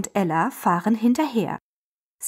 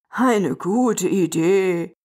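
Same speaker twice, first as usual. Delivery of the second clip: put-on lower, very slow old engine voice; old grandpa agreeing; 3 semitones lower, much slower than usual. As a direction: agree slowly like an old locomotive. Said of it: "stimmt auch Opa Adler zu". (Opa Adler stimmt langsam zu)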